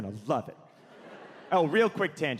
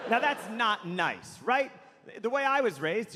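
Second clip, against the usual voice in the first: ordinary talk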